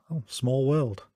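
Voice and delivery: lightly mocking sarcasm in a retold line; dismissive, sarcastic. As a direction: imitate a dry, dismissive quip. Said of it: "so dismissive and, and so sarcastic".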